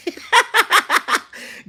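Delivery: loud, high squealing laugh; high burst of laughter. Real, not acted. Laughing out loud at a viewer's name. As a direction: let out a high cackle. Reacting to funny username.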